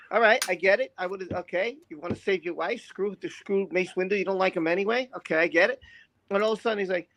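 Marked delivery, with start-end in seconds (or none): none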